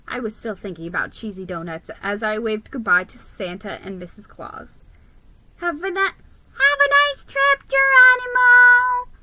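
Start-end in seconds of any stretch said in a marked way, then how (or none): 5.6-9.1 high, loud chirpy elf voices; squeaky elves calling a farewell. A narrator's reading. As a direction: call out like cheerful little elves. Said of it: "the elves called".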